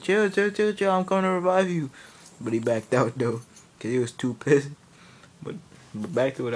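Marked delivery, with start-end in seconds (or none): 0.0-1.9 loud, high rapid-fire plea; urgent pleading. Retold or imitated. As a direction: beg a raging teammate to calm down. Begging angry Philip to stay.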